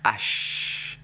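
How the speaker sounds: H said "ash" with a long sh; name H in French as "ash"; H drawn out as "ashhh"; French letter name. Shown as a demonstration. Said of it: "Of course, it's la lettre".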